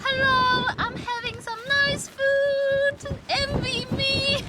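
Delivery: high, slow cutesy gloating; playful teasing. Acted. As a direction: tease the viewers sweetly. Teasing viewers in a cute voice.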